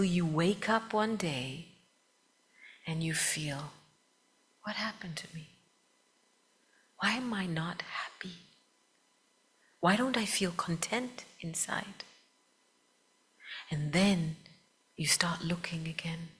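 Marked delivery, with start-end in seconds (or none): 4.6-12.0 soft whispered self-questioning; lost inner voice. Retold or imitated. Adult waking up unhappy, asking himself quietly in a whisper. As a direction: whisper it like a private doubt.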